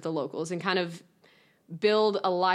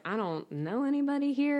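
higher, a little lost and overwhelmed; mock lost newcomer; 7 semitones higher than usual. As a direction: play the lonely new kid. Acting out lonely arrival.

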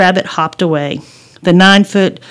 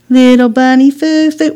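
higher, louder teasing chant; singsong taunt; 6 semitones higher, 5 dB louder than usual. Acted. Brother bopping his sister on the head, taunting. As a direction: tease like a bratty kid brother.

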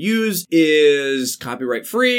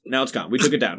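ordinary talk

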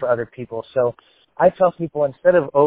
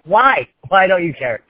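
high, loud mock-exasperated plea; exasperated disbelief; 5 semitones higher, 5 dB louder than usual. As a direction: plead in playful disbelief at their indifference. Mock plea to uninterested listeners.

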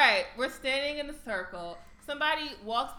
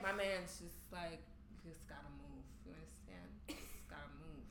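muttered; 8 semitones lower, 16 dB quieter than usual. Retold or imitated. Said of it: "Cletus was like".

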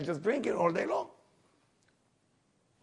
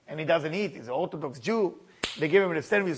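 normal talking voice

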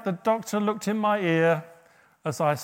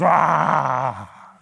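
dramatic cry; 2 semitones lower, 8 dB louder than usual. Acted. Cries out after the psalm line.

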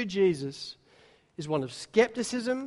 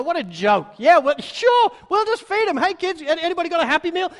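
high, louder mock-incredulous jeering; sarcastic mockery; 8 semitones higher, 5 dB louder than usual. Disciples mocking Jesus' feeding plan, high sarcastic jeer. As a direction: scoff at the idea, playing it for laughs. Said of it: "They're being sarcastic with him".

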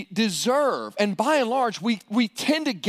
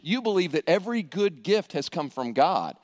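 ordinary talk

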